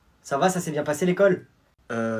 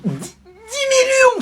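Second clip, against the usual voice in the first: pitched far higher and drawn out slowly; dreamy, high, slow "dix millions"; greedy awe; 9 dB louder, much slower than usual. Acted. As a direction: savour the sum like a dream. Greedy repeat.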